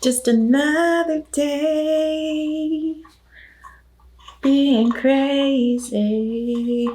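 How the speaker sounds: slow, high, stretched-out singsong; high sing-song. Pretend.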